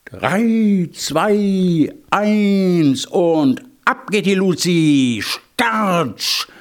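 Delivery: high, stretched-out race countdown; starter's countdown. A narrator's reading. Narrator starts hare and hedgehog's race, calling the countdown. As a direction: count them off slowly, pitched high.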